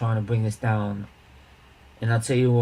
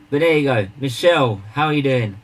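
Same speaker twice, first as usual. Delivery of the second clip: ordinary delivery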